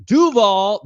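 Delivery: slow, emphatic "Duval"; correct Duval shout. Shown as a demonstration. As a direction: call out "Duval" with a strong push. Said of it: "That's how you say it".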